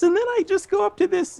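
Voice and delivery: high comedic pitch; Jerry Seinfeld. Retold or imitated. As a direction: imitate an upset comic going way up high. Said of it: "that high comedic pitch".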